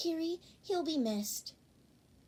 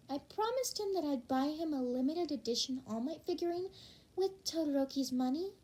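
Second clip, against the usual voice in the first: usual speaking voice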